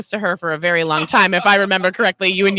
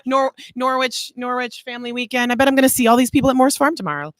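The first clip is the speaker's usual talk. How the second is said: excited quote; 6 semitones higher than usual. Retold or imitated. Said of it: "I was like".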